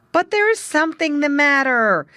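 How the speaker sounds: high, loud insistence; young muskrat insisting to the old frog. Acted. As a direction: press the point firmly. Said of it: "insisted Jerry Muskrat".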